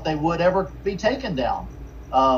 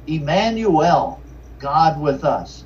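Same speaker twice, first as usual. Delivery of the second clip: slow emphatic reading; slower than usual. Shown as a demonstration. Preacher dwells on the name.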